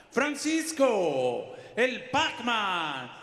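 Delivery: high, loud, very slow name call; drawn-out name call. Real, not acted. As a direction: stretch every syllable to the crowd. Announcer calls the fighter's name.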